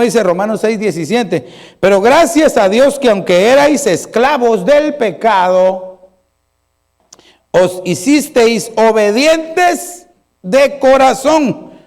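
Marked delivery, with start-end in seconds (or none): 1.8-11.7 slow, emphatic pulpit reading; proclaimed scripture reading. Acted. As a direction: declaim the verse with drawn-out key words. Reads scripture aloud with force.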